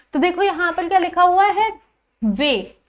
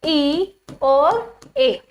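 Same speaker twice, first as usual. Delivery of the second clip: very slow, stretched vowel sounds; drawn-out vowel demo; much slower than usual. Shown as a demonstration. Teacher stretching the ई sounds slowly for the class. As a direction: hold each vowel long and clear. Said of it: "मैंने आपको sound बताई ई".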